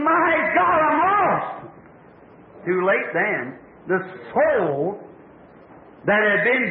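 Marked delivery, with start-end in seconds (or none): 0.0-1.7 high-pitched mock cry of terror, not full volume; retold desperate scream. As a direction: imitate a dying woman crying out in despair. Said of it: "she screamed".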